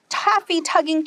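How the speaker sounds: ordinary delivery, nothing marked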